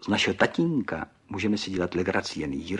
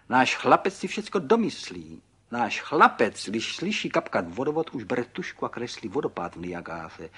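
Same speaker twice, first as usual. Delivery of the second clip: high, loud, proud father; delighted boasting; 5 semitones higher, 7 dB louder than usual. A narrator's reading. Pan Burgán boasts of his son's imagination, delighted. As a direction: brag excitedly, punching the key words. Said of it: "radoval se maličký pan Burgán".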